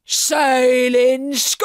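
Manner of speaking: loud, drawn-out pirate roar; Captain Blackheart roaring. A narrator's reading. Pirate captain throws the boy's words back, roaring. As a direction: roar it slowly as an incredulous question, rising at the end. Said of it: "roared Captain Blackheart".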